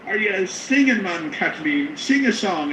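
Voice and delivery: high, loud, eager fan voice; excited. Retold or imitated. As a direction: gush at them like a starstruck stranger. Strangers' excited questions, quoted.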